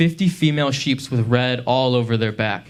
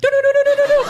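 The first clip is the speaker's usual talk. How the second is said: very high, louder rapid do-do-do, half laughing; high warbled do-do-do; 5 dB louder, faster than usual. Retold or imitated. Comic repeats the high warble as the woman's gag. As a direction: yodel a quick high warble. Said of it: "audibly went".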